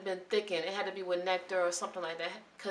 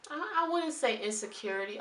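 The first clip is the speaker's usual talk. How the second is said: ordinary talk, nothing marked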